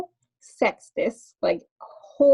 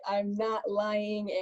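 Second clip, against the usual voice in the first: usual speaking voice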